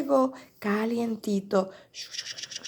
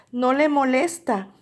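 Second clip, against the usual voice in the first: ordinary delivery, nothing marked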